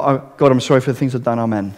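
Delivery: mock careless confession, fast and dropping away; rushed vague prayer. Shown as a demonstration. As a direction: rattle off a quick throwaway prayer, trailing soft at the end.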